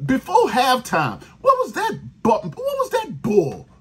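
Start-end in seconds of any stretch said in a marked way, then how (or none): 1.4-3.7 outraged, voice cracking; voice cracking in outrage. Real, not acted. Furious rant at team's play calls.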